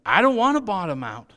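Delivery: high, loud protest in a listener's voice; reluctant listener. Pretend. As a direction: play someone in the pews objecting loudly. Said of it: "You might be somebody else here saying".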